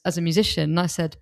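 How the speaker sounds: ordinary talk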